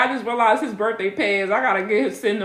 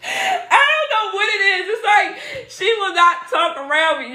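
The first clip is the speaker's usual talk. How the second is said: laughing hard; 10 semitones higher than usual. Real, not acted. Laughing at niece's shyness.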